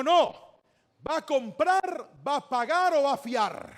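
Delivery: loud, high, insistent shopkeeper; public confrontation. Acted. Shopkeeper shaming boy before customers, confrontational. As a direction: put the boy on the spot loudly.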